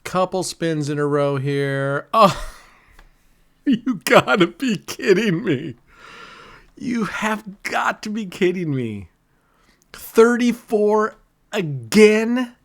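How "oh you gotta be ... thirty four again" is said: high, incredulous protest; stunned, drawn-out outrage at the wheel; exasperated disbelief. Real, not acted. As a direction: cry out at rotten luck, pitch raised. Same number lands again, exasperated gambler.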